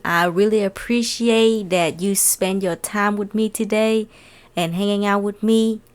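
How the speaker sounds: normal talking voice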